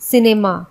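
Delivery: सिनेमा said with a soft s sound at the start. Shown as a demonstration. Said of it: "तो हमने इसे पढ़ा सिनेमा". (सिनेमा में स की ध्वनि)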